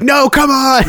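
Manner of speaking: high, laughing mock protest; imitating a listener's playful outrage. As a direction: play the disappointed listener for laughs. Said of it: "I'm sure you were just like".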